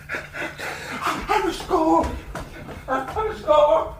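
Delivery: high, drawn-out desperate cry; distraught, pitched far up; panicked. Acted. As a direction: wail it slowly in mounting panic. Panicking lecturer flees the hall.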